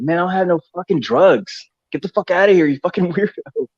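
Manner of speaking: yelling at a crackhead, retold with a grin; mock yell. Friends yelling at a crackhead begging drugs, mock angry. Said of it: "literally yelling at this guy".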